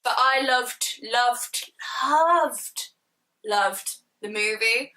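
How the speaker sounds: drawn-out gushing repetition, rising then easing. Real, not acted. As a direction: savour every loved. Gushing repeated loved.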